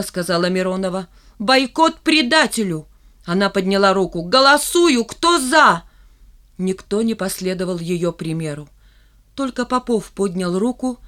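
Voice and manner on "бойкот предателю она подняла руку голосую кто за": loud, forceful call to vote; rally the class, loud and insistent; commanding shout. A narrator's reading. Миронова demands the class vote, loud and commanding.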